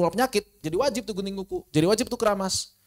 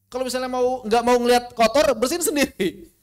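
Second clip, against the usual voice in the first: high, loud retort to a husband; pitched-up, scolding mock reply; imitating curt wife; 6 semitones higher, 6 dB louder than usual. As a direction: act out a wife talking back, voice raised. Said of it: "enggak bilang begitu juga ke suaminya".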